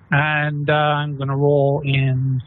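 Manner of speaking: normal talking voice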